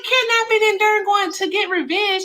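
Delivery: high-pitched, incredulous outburst; exasperated and disbelieving, high throughout; indignant rebuttal. Real, not acted. Heatedly disputing his claim.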